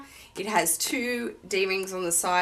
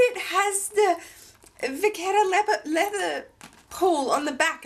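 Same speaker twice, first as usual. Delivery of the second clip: stammering excitement; 9 semitones higher than usual. Retold or imitated. Retelling her shock to the shop assistant, stammering high. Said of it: "I'm sort of stuttering".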